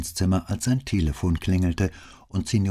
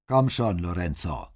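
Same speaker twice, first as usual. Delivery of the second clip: barsches Rufen; 2 semitones higher than usual. A narrator's reading. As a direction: call him over gruffly. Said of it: "mit einer barschen Geste und rief". (barsch gerufen)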